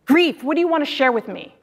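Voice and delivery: ordinary delivery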